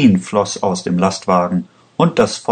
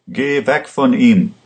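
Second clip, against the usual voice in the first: a touch of a shout, voice raised; mild shout; 4 semitones higher than usual. A narrator's reading. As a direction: order the guard off him. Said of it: "schrie er den Beamten an".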